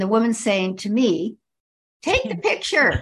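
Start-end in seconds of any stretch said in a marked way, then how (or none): 2.0-3.0 higher, pushy command; bossy wife's order. Retold or imitated. Overbearing wife ordering the narrator to snap the photo, a pushy high command.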